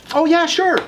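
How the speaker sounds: high, over-friendly landlord voice; eager landlord. Retold or imitated. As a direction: gush like a landlord who smells a deal. Landlord eagerly agrees on phone.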